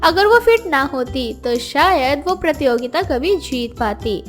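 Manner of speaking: usual speaking voice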